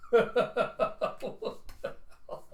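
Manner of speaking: high, loud words through real laughter; laughing. Real, not acted. Storyteller amused by own story, laughing hard. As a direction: crack up while speaking, drawn out. Teller cracks up at the scene.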